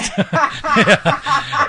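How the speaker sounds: a loud, high laugh that bursts out; laughing at your own story; high burst of laughter. Real, not acted.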